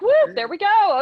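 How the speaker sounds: high, loud playful whoop; excited squirrel mind. Acted. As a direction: dart off gleefully like a distracted squirrel. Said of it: "my squirrel mind is like".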